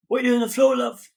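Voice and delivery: playful attempt at a nurse's British accent; imitating a British nurse. Nurse finding him on the floor, light British accent. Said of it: "my attempt at a very good accent".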